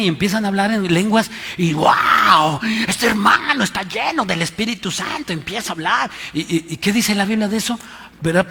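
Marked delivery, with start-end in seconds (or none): 0.0-6.1 mocking hype. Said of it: "el apóstol Pablo se está burlando".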